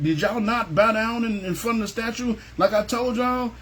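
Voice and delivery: lightly voiced king confronting them; angry king questioning. King of Babylon confronting the three men, mock stern. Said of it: "So he brought them up there".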